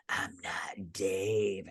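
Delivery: low, slow menacing whisper; a whispered slasher threat; creepy killer whisper. Retold or imitated. Ghostface voiced in retelling.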